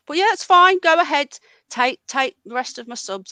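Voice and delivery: loud, high mock cheerfulness; bitter sarcasm, faking being fine; sarcastic. Real, not acted. Streamer bitterly invites Ron to take his subs, sarcastic.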